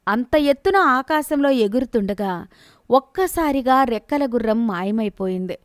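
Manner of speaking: usual speaking voice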